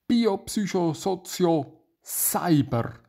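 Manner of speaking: slow, high, emphatic announcement; proclaiming. Real, not acted. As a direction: proclaim the term piece by piece. Proclaims the term after whispering it.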